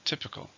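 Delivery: "Typical" stressed on "Typ"; first-syllable stress. Shown as a demonstration. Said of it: "stresses in the first".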